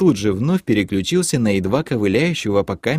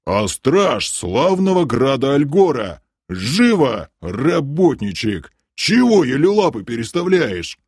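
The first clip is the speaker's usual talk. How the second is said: berating guard; 4 semitones higher than usual. A narrator's reading. Guard berating the dawdling prisoner.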